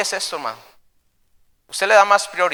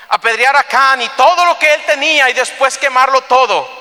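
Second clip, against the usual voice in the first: raised, high, emphatic delivery; impassioned preaching; 8 semitones higher, 6 dB louder than usual. Acted. Preacher describing the stoning of Achan, impassioned. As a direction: drive the grim list home.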